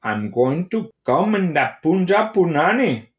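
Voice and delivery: high, hammed-up Indian accent; exaggerated sing-song Indian accent; horrible Indian accent. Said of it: "use my horrible Indian accent and say to her".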